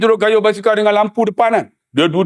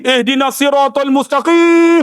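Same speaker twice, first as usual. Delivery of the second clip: recited verse; 5 semitones higher than usual. Retold or imitated. Uncle recites verse, laughing.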